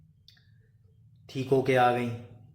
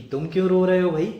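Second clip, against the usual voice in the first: higher, louder and fast question; loud teasing call; 8 semitones higher, 10 dB louder, faster than usual. Retold or imitated. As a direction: call out to the buffalo, quick and loud. Asking the crying buffalo.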